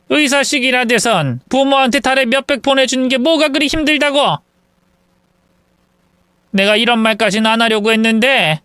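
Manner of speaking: indignant mother lecturing her grown son; scolding mother-in-law. Acted. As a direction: berate the son, warning him of tears later.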